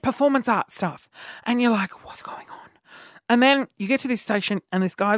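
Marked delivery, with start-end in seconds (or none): 2.1-2.7 very soft whispered confusion; hushed mock bewilderment; retold bewildered whisper. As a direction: whisper it, imitating a baffled participant. Retold bewilderment in weird performance.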